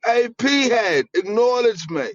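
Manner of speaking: high, hammed-up Aussie voice; mock Australian. Friend joins the joke, high mock Australian accent. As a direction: call out like a pushy Australian bloke.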